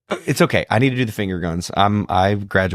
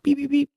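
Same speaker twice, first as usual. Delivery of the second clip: high mock beeping sounds; scanner beeps. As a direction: beep like a checkout scanner. Dad imitates scanner beeping.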